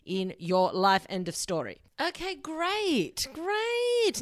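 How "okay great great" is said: high, drawn-out "great"; exaggerated approval. Speaker wrapping up her point for listeners, exaggerated approval. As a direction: stretch it out slowly, pitched right up.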